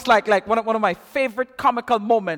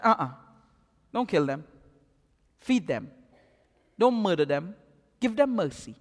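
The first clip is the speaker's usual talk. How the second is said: Elisha's gentle plea; 7 semitones lower, 8 dB quieter than usual. Retold or imitated. Elisha gently refuses the killing.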